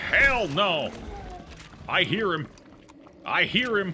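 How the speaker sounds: high, very loud real alarm; alarmed shout. Real, not acted. Player hearing the monster coming, alarmed shouting. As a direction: cry out as the monster closes in.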